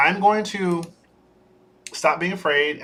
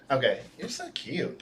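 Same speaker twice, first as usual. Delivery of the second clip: ordinary talk, nothing marked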